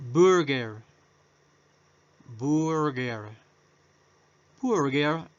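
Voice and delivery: burger said slowly in English, stressed on BUR with a rolled r; model pronunciation. Shown as a demonstration. Teacher modelling Burger for students, slow and clear. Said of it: "la podemos también dividir en dos partes".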